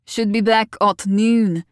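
slow, terse answer in a local man's voice; a mild grunt of a reply. A narrator's reading. Keats answering as his guest heads out, grunted. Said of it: "He grunted in response".

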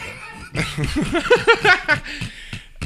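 stoned high-pitched giggling; high giggle. Real, not acted. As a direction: giggle helplessly. Giggle fit.

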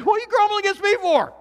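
high, loud exasperated question; indignant Moses. Acted. Moses confronting the grumbling Israelites, high exasperated. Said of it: "Moses is saying".

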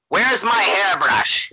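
slow, high mock-accent impression; Boris. Co-host guessing how he'll answer, mock accent impression. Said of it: "Boris is gonna be like".

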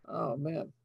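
normal talking voice

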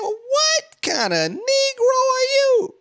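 very high, slow, wailing outrage; shrill sobbing outrage. Retold or imitated. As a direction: cry it out in shrill disbelief. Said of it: "I said".

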